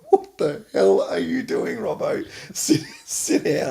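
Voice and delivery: high shouted snap at the name, then a laughing "sit down"; coach's angry yell. Retold or imitated. Coach yelling at Robbo across the change room, a mock angry shout. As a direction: bark the name, then wave him down. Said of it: "I'm going".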